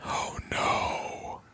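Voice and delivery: breathy whispered "oh no"; Tommy watching the man drop, whispering; whispered dread. Retold or imitated. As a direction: whisper it in horror. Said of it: "Tommy's just like".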